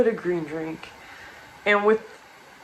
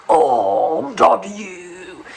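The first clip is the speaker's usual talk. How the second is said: prospector voice; slower than usual. Retold or imitated. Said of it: "turns into a prospector".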